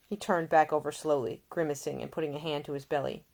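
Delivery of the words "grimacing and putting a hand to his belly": ordinary delivery, nothing marked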